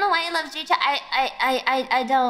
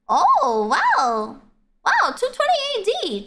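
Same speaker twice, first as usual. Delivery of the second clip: amazed; 9 semitones higher than usual. Real, not acted. Streamer learning how old Cantonese is, amazed. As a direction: swoop up high, then drop in astonishment.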